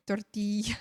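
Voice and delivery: tortilla with a Spanish tap r and ya ending. Shown as a demonstration. To chat viewers, Spanish tortilla. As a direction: tortilla said the Mexican way, rolled r. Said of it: "ee, tortilla".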